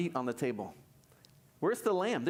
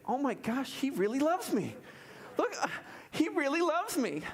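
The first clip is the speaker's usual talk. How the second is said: mock wife voice; 9 semitones higher than usual. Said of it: "would my wife say".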